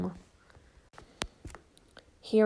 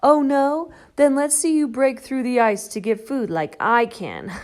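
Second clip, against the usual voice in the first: taunting; 3 semitones higher, 7 dB louder than usual. Acted. Duck taunts Shelley.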